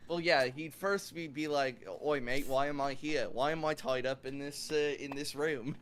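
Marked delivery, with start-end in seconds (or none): 2.0-5.8 hammed-up Aussie accent, a touch louder; horrible Australian accent. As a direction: put on a bad Australian accent. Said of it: "horrible, uh, Australian accent".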